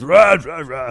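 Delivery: loud, high growling "rah"; mock monster roar. Imitating Doomsday growling at the lasso, a hammed-up roar. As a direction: ham up a roaring brute.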